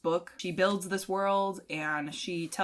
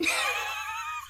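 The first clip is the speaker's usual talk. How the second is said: high squeal laugh. Retold or imitated. Mimicking giddy reader.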